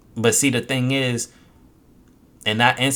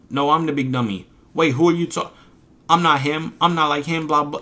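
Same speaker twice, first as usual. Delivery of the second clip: higher-pitched mock squabble; mock protest, raised and hurried along; mocking imitation; 5 semitones higher, 3 dB louder than usual. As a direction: mimic bickering chatters, pitched up. Mimics chat members one-upping each other.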